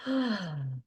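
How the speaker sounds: long, soft falling sigh; big exhale sigh. Shown as a demonstration. Guide showing listeners a big exhale, long sigh. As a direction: let out a long, releasing breath. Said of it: "just let out a big exhale".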